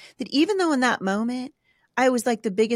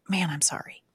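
soft, near-whispered regret; quiet apology; 2 semitones lower, 6 dB quieter than usual. Retold or imitated. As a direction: say sorry under your breath, sincerely. Modelling a parent's apology.

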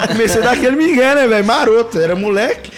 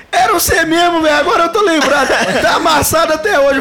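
a higher, louder, laughing voice for the truck owner; amused truck owner; 8 semitones higher, 3 dB louder than usual. Retold or imitated. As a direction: play the owner cracking up as he remembers.

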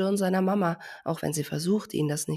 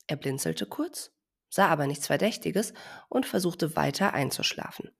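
usual speaking voice

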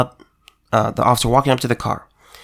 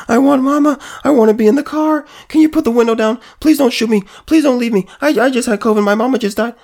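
mocking whiny plea; 10 semitones higher, faster than usual. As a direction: ham up a panicked man begging. Mocking the dying man's pleas.

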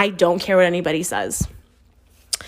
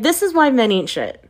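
normal talking voice

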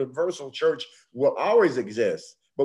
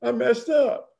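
put-on higher, small voice of a sorry child; little girl confessing; 7 semitones higher than usual. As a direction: ask it like a guilty granddaughter. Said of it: "And she said".

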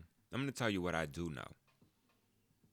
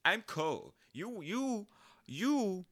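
put-on high incredulous teen protest; indignant disbelief; 5 semitones higher than usual. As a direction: squeal it, baffled and offended. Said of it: "That's how I felt".

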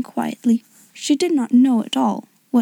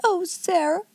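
high, soft whimper; plaintive, helpless sigh of a name; almost whimpering; 7 semitones higher, 5 dB quieter than usual. A narrator's reading. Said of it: "almost whimpered Miss Amelia".

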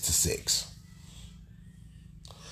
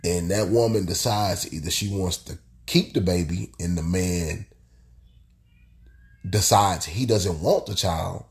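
ordinary talk, nothing marked

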